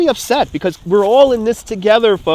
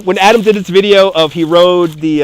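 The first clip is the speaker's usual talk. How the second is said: ordinary talk, nothing marked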